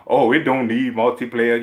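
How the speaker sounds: mocking quote. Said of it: "the shit I've been reading".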